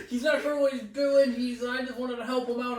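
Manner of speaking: high, loud mock condescension; condescending guest. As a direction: imitate a patronising guest putting the host down. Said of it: "condescending right from the start".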